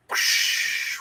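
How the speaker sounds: voiceless rush of gushing water; imitated whoosh. Telling how water poured from the car, imitated whoosh. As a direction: make a splashy whooshing noise.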